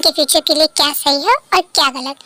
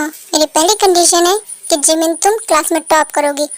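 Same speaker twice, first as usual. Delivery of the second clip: usual speaking voice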